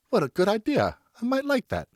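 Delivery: mother warmly answering her son; lightly voiced mother. A narrator's reading. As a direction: coo it like a loving mother.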